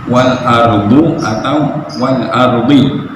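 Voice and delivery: slow wal ardu, wal ardi with wrong endings; wrong vowels. Shown as a demonstration. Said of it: "dia bacanya". (bacaan salah wal ardu atau wal ardi)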